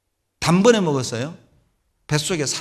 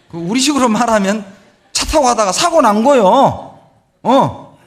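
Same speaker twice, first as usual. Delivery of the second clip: emphatic preaching; 8 semitones higher, 8 dB louder than usual. Real, not acted. Preacher drives the point home.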